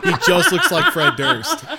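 high, loud, slow laughing insistence. Real, not acted. Mocking the movie's costume, laughing loudly. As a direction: laugh it out in disbelief. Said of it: "Like, I'm not wrong".

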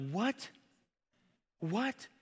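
high-pitched, rising incredulous question; mock disbelief. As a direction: react as if stunned by the list. Stunned reaction to Paul's list.

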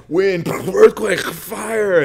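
loud, high blasts, word by word; dramatic outburst. Acted. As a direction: burst out each disaster like an explosion. Acting out the violent disasters.

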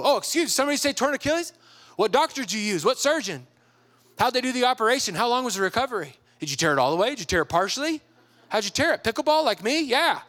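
high, excited rapid-fire questions; eager strangers. Retold or imitated. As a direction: imitate nosy partygoers pouncing on shared news. Acted eager strangers at parties.

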